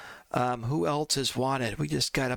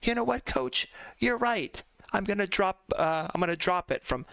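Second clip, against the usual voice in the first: higher, lightly voiced happy player; player's voice; 4 semitones higher than usual. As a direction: mimic the player giving in cheerfully. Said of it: "He goes".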